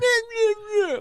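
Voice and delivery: very high, whiny meh meh meh; whiny husky. Retold or imitated. Husky owner mimicking his dog whining back. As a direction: whine like a petulant toddler dog. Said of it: "They'll be like".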